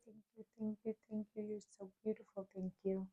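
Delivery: soft, high, hushed thank-yous; tender thanks. Real, not acted. Thanking the flowers as she snips, soft and high. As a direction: murmur thanks to the flowers like a lullaby.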